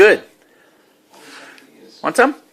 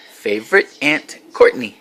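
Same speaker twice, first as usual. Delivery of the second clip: word by word; 4 semitones lower than usual. Shown as a demonstration. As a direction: model "Favorite Aunt Courtney" for a child, pausing between words. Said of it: "Can you say".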